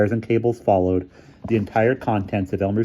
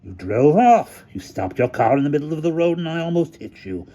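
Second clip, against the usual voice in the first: character voice; 8 semitones higher than usual. A narrator's reading. Hamilton retorts.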